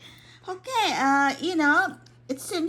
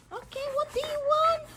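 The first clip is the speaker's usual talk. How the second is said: high pet voice; 12 semitones higher, 5 dB quieter, faster than usual. Real, not acted. High baby talk to dog.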